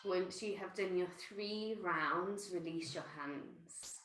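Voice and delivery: soft, gentle meditation voice; guided meditation. Acted. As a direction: ease them inward, softly.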